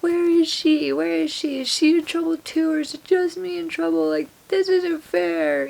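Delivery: high-pitched whiny wail, hammed up; mock crying yell. Drunk teen in hospital demanding her friend, mock tearful whine. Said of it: "crying, yelling, and I was like".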